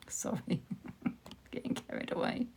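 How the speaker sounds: hushed, sheepish laugh through the words; real quiet laughter, barely voiced; laughing apology. Real, not acted. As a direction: giggle softly while apologising for getting carried away. Laughing apology after angry outburst.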